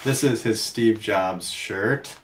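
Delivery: usual speaking voice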